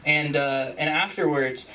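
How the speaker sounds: ordinary talk, nothing marked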